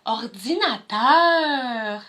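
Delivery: ordinateur drawn out very slowly; stretched out. Shown as a demonstration. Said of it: "Ma chorégraphie de danse-théâtre".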